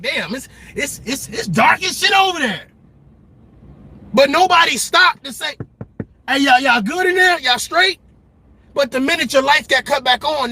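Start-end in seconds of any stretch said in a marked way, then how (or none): 0.0-2.7 mock gawking neighbor, higher; nosy neighbor hollering at the dark house; gossiping neighbor. 6.3-8.0 higher, a bit louder mock check-in; caring neighbor.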